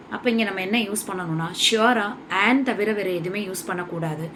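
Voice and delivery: ordinary talk, nothing marked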